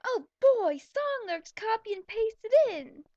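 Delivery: loud, high, slow groan of dread; loud sarcastic dread. Real, not acted. Reader groans aloud at the pasted song lyrics. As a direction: drag it out in loud mock dread.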